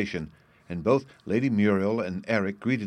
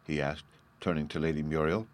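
ordinary delivery, nothing marked

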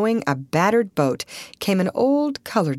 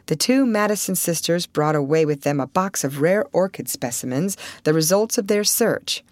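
ordinary delivery

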